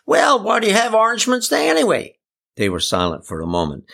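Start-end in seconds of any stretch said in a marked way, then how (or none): 0.1-2.2 high, sustained bellow at moderate volume. A narrator's reading. Eli to villagers who don't understand, high bellow. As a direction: bellow it out high on a big breath. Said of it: "he took a deep breath and bellowed out".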